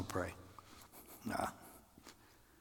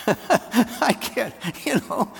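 high whiny plea; 12 semitones higher, 9 dB louder, much slower than usual. Retold or imitated. Retold plea to God.

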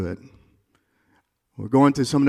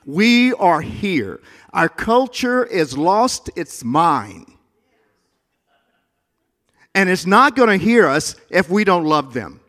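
loud, high, slow proclamation; urgent call to the church; preaching at full force; 5 semitones higher, 7 dB louder than usual. Real, not acted. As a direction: lift the room with it.

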